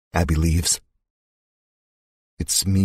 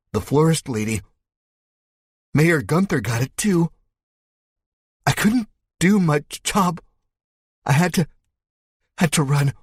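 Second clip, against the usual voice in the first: high, shaken survivor recounting horror; a bitten man reliving the nightmare; terrified survivor; 9 semitones higher than usual. Acted. Dokes telling the narrator about the dead, high and frightened.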